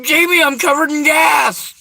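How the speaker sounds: screaming at the friend lighting up; panicked shout. Retold or imitated. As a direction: shout the warning at her. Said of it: "I'm like".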